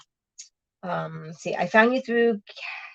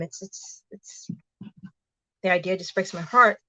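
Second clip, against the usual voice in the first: choking up; 5 dB quieter than usual. Real, not acted. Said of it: "I'm trying not to cry".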